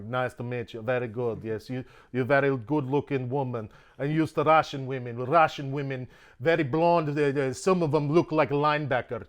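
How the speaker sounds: put-on Russian accent, halting broken English; thick comic Russian accent. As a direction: play a friendly Russian flirting in a bar. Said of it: "charge you money for the fucking accent".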